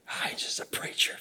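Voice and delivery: hushed whisper of people gossiping; breathy gossip whispered behind a hand. Retold or imitated. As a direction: whisper it like a rumor spreading. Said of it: "You can just hear".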